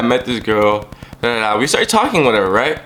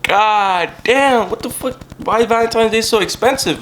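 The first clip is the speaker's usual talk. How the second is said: mock shock; 9 semitones higher than usual. Bear costs almost everything.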